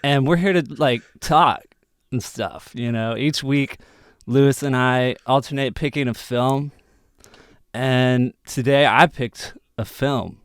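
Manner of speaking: high, loud, halting slacker voice; Justin Long impression. Podcast host introducing the film as a celebrity impression. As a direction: pitch it up and drag out the names, like a dazed dude. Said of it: "that was my best Justin Long impression".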